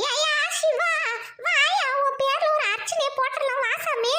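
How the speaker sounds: put-on high, fond old granny call; grandmother calling. Grandmother calls Siva over for the temple offering, high and fond.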